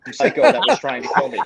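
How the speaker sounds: fast, laughing through the punchline; can't hold the laugh in. Real, not acted. Telling friends about Bryan's prank, laughing through the end.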